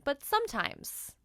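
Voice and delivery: plain reading voice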